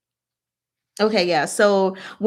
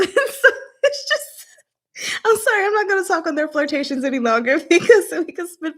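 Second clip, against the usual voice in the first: high, fast, laughing through it; giggling apology; 7 semitones higher than usual. Real, not acted. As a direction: apologise while still giggling.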